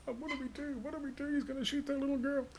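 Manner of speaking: panicked soldiers. Retold or imitated. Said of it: "the good soldiers were like".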